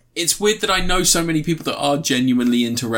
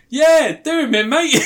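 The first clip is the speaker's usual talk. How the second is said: high, loud cheer breaking into laughter; hammed-up British wrestling fan; mock fan cheer; 12 semitones higher, 5 dB louder than usual. Imitating a British fan egging on a wrestler, high laughing shout.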